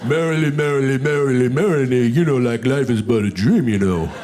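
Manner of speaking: Sylvester Stallone voice. Acted. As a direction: slur it low like Rocky. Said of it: "like Sylvester Stallone".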